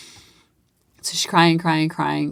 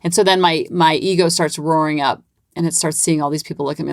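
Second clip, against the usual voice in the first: ordinary talk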